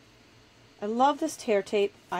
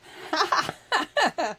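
rueful laugh; 7 semitones higher, 4 dB louder than usual. Real, not acted. Laughs at her bad day.

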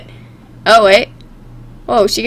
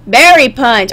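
normal talking voice

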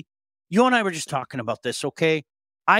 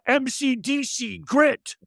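high, drawn-out imitation of fans; mock fan cheer; 9 semitones higher, slower than usual. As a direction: cheer it slowly like an adoring local fan. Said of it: "everybody around here would go".

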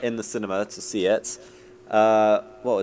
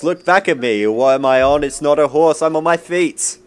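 ad pitch parody; 3 semitones higher, 9 dB louder than usual. Retold or imitated. Quoting the Old Spice advert.

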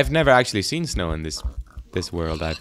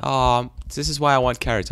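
ordinary talk, nothing marked

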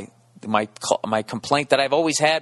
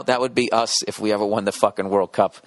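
ordinary talk, nothing marked

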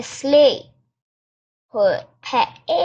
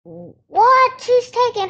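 surprised; 12 semitones higher, 4 dB louder than usual. Real, not acted. Friend drives off.

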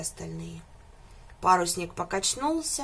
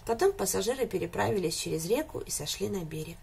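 usual speaking voice

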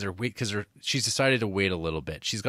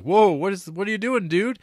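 much higher, louder alarmed shout; crowd alarm; 9 semitones higher, 6 dB louder than usual. Retold or imitated. Bystanders seeing him cross the tracks, alarmed shout. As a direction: shout a warning at the runner. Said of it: "everyone's like".

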